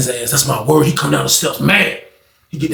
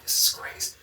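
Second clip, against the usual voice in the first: stunned whisper; 16 dB quieter than usual. Retold or imitated. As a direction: breathe it in shock. Stunned listener hearing a fight.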